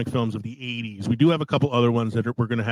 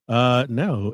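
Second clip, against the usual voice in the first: ordinary talk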